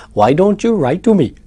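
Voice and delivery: a light mock Indian accent. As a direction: imitate an Indian voice saying Why don't you write to me. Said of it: "with the whole Indian".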